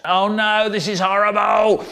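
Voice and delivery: high, sustained cry of distress; hammed-up storybook horror; horrified wail. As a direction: wail it all on a high note. Acted horror at hot oil.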